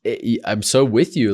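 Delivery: normal talking voice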